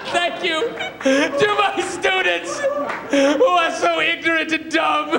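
high, slow, mock-sobbing acceptance speech; James Lipton, weeping speech. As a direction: blubber through the thanks, grandiose to the end. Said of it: "he starts crying at the very end".

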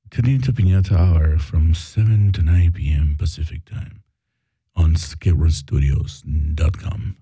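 low, sultry radio promo; sexy radio voice. Shown as a demonstration. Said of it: "just say it louder".

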